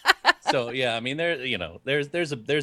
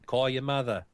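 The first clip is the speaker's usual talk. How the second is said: put-on New Jersey accent; 4 semitones higher than usual. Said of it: "in that New Jersey accent".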